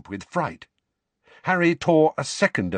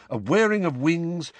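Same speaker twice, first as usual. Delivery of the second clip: ordinary delivery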